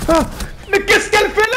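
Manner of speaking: sudden high outraged yell; shocked shout. Real, not acted. Shocked at enemy's move.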